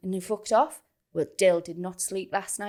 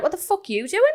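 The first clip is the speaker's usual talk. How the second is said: raised pitch, retold confrontation; acted angry challenge; 9 semitones higher, faster than usual. Partner confronting youngster by the fence, acted angry challenge. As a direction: challenge him as the indignant partner.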